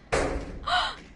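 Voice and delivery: sharp, high intake of breath; startled gasp. Real, not acted. Player spooked by something in the game, startled gasp. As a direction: gasp in sudden fright.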